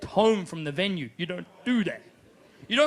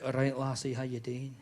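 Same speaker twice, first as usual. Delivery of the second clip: low, soft Scottish seducer; hushed, deep Scottish chat-up; Scottish accent; 8 semitones lower, 7 dB quieter than usual. Acted. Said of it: "work out a bit of a Scottish accent".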